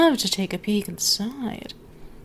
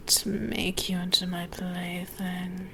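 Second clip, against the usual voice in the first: soft teasing whisper; playful menacing whisper; 7 dB quieter than usual. Acted. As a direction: breathe it close to the ear. Teasing threat, unvoiced.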